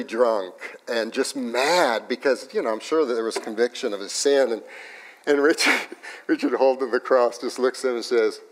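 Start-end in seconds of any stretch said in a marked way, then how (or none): none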